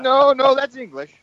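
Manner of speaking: high-pitched amused correction; quoted reply. Retold or imitated. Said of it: "I said".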